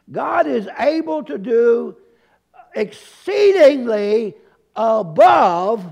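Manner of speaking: EXCEEDINGLY and ABOVE drawn out and loud; slow, emphatic build to a loud ABOVE; emphatic repetition. Real, not acted. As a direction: hammer EXCEEDINGLY, then ABOVE, with pauses before each. Repeats the verse for emphasis.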